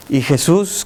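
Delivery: ordinary talk, nothing marked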